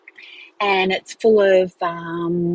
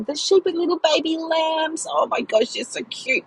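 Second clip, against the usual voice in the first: cooing over lambs; 9 semitones higher than usual. Real, not acted. Gushing over baby lambs.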